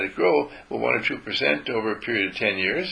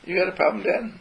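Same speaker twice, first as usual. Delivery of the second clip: much higher cheeky dog voice; voicing the dog; 8 semitones higher than usual. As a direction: give the dog a sassy little voice. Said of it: "He'll just look at me and say".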